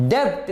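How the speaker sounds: डेब्ट with the b wrongly sounded; the silent b voiced in डेब्ट; wrong pronunciation. Shown as a demonstration. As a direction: say डेब्ट with an audible b before the t. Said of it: "नहीं पढ़ना जी इसको".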